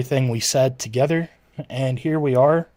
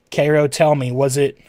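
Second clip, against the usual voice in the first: usual speaking voice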